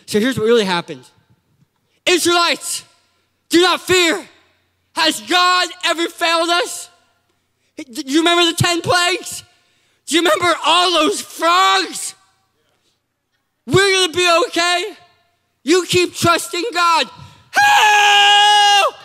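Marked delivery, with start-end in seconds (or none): 2.0-17.1 high, projected mock oratory; rally the crowd with big declaimed phrases; epic rallying speech. Moses reassures the Israelites, epic rallying speech. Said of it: "Moses gives this epic speech". 17.5-18.9 high, loud, long cry for help; retold panicked scream. Moses screams to God after his speech, mock panic. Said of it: "he's screaming to God".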